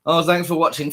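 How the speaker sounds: mocking the TV host.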